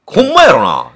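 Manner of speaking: pressing doubt. Retold or imitated. The father presses the chef that it's really true, loud and suspicious. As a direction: lean in hard, suspicious.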